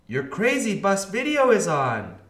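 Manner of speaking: high, loud call to little sister. A narrator's reading. Arthur luring D W to the video, calling out. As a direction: call out an inviting announcement. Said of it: "Arthur called".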